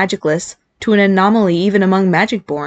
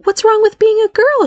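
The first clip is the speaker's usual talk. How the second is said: higher, questioning mother; mother's voice; 4 dB louder than usual. A narrator's reading. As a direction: ask her son a bit hurt. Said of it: "she asked".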